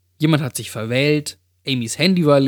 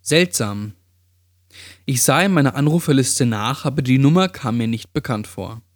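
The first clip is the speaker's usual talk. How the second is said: ordinary delivery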